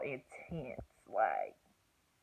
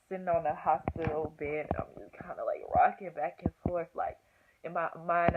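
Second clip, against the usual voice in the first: ordinary talk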